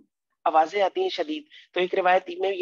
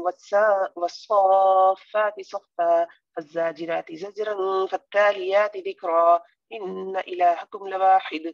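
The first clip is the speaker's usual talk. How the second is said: slow, drawn-out Quranic chant; Quran recitation; slower than usual. Acted. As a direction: recite each phrase slowly and reverently. Teacher recites surah opening slowly.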